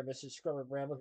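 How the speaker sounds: ordinary delivery, nothing marked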